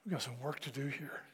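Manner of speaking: muttered aside. Retold or imitated. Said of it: "and I'm going".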